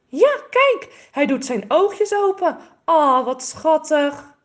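high, loud, gushing delight; delighted squeal. Acted. Girl gushes over the baby bird to her brother, high and delighted. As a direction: coo over the tiny bird, thrilled.

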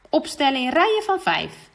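put-on line the children up; swim teacher's call. Swim teacher calling children to line up, loud.

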